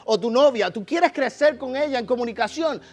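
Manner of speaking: loud, high sermon push; rally the congregation from the pulpit; full-force preacher; fervent preaching. Acted.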